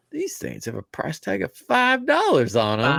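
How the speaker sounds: high, put-on amazed voice; imitating him marvelling at free stickers, in a high voice; imitating Katrin. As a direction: ham up his sticker excitement. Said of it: "he was like".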